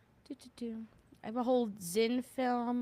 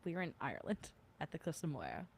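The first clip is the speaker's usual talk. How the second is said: softer, quicker, with a small laugh; quiet correction; 3 semitones lower, 11 dB quieter, faster than usual. Real, not acted. Correcting her slip to the chat, softly and quickly. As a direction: set the record straight under your breath. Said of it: "I meant Ireland. Sorry".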